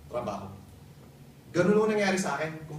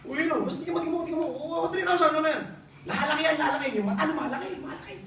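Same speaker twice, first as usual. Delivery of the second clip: excited boss; 10 semitones higher than usual. Retold or imitated. Boss excitedly shows bank passbook.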